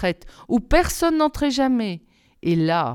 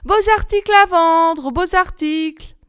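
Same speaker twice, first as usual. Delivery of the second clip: much higher, louder hawker's call; sing-song street seller's cry; peddler crying out; 9 semitones higher, 5 dB louder than usual. A narrator's reading. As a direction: call out your wares like a disguised old peddler. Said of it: "frappa à la porte et cria".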